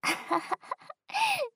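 high, bright burst of laughter; delighted giggle; amused laugh. Acted. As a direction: laugh at his reaction. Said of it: "すごい声。".

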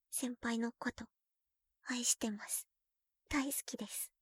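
quiet tender love words; whispered I love you; soft whisper. Acted. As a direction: breathe it softly.

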